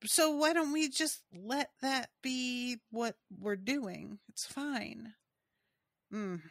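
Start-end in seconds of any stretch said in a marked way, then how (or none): none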